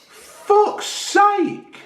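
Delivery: high, fed-up curse; exasperated. Real, not acted. Fed up with the doctor's warning, exasperated. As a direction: throw it away in disgust.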